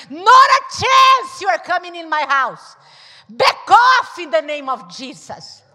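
shouted rebuke in Jesus' name; loud rebuke. Retold or imitated. Wife rebuking Satan to husband's face, shouting. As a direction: drive Satan out with a loud command. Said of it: "I tell in his face".

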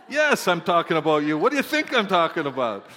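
exasperated retort. Acted. Preacher answering a self-conscious churchgoer, exasperated retort. Mock retort to congregation's worry.

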